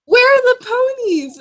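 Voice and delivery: put-on high, slow little-kid voice; childlike voice. Mocking the innocent child's question, high and slow.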